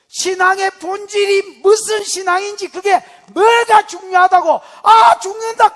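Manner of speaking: high, loud crying wail that he is dying; frantic outcry. Acted. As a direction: cry out in panic.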